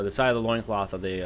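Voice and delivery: usual speaking voice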